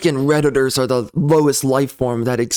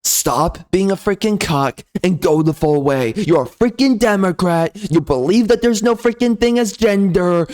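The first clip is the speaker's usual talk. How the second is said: normal talking voice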